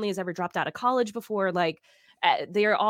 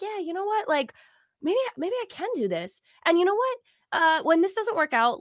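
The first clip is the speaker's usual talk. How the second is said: much higher, bravado that doesn't quite hold; fake confidence; 9 semitones higher than usual. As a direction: pump yourself up without believing it. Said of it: "kind of fake confidence where I'm like".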